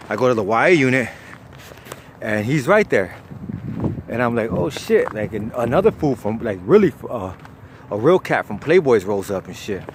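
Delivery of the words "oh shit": higher-pitched retold surprise; surprised recognition. Narrator spots old cellmate in unit, surprised. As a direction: replay a startled recognition.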